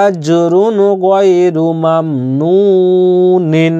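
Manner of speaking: slow tajweed recitation of আজরুন গায়রু মামনুনিন, drawing out each syllable; slow Quranic recitation. Shown as a demonstration. Teacher demonstrating the verse to students, slow recitation. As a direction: chant আজরুন গায়রু মামনুনিন slowly with the nun held. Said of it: "এখানে দম ছাড়লে কি হতো?".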